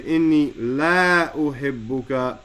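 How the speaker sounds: "la" stretched long between "inni" and "uhibbuka"; "la" held long, as if negating; long la with alif. Shown as a demonstration. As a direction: draw out the vowel of "la" well before "uhibbuka". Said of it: "with the alif".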